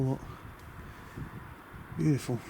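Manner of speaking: usual speaking voice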